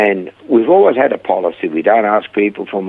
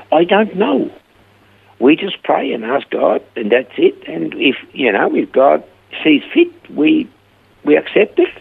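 lifted, higher voice all through the quote; earnest retold answer; 8 semitones higher than usual. To the skeptical client, explaining they just pray, raised in pitch. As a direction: replay your own reply lightly, pitched up.

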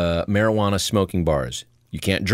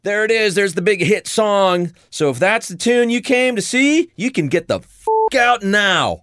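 rock singer voice; 12 semitones higher, 4 dB louder than usual. Retold or imitated.

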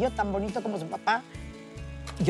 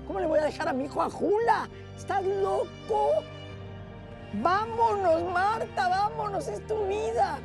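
pleading high voice; 10 semitones higher than usual. Retold or imitated. Ex-partner begging to leave.

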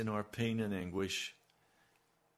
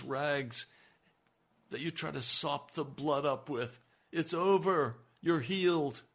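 higher, louder, exultant preaching; joyous preaching; 5 semitones higher, 6 dB louder than usual. Acted. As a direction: lift the joy over the congregation. Rejoicing over the healed woman.

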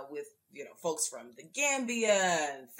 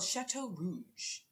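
"Chateau Rouge" said in a light mock French accent; a hint of a put-on French accent on "Chateau Rouge"; fake French accent; 4 dB quieter, much slower than usual. Shown as a demonstration. Host giving the quiz answer, lightly mock French accent. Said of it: "This is my fake French accent".

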